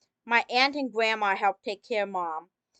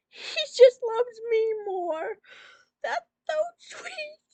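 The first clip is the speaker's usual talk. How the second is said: high, slow fake sobbing; mock crying; 14 semitones higher, slower than usual. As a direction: wail it through fake tears. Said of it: "I'm sorry, I'm just".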